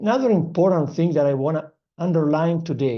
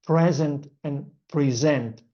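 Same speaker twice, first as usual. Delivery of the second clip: "present" as PRE-zent, then "present" as pre-ZENT; contrasting stress. Shown as a demonstration. Teacher showing tricky words, "present" stressed first PRE, then ZENT. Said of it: "These kind of words are very tricky".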